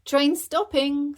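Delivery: very high loud sing-song call; train conductor call. Acted. Bingo driving the toy train, conductor call. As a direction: announce it like a conductor. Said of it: "said Dad".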